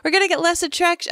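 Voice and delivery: high, fast mimicked Ian; a lightly voiced Ian, high and fast; mock Ian. Cohost mimicking Ian's line about attention, high and fast. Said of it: "Ian is like".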